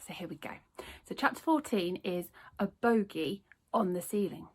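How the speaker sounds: usual speaking voice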